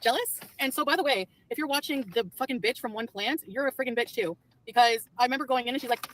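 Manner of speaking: ordinary talk, nothing marked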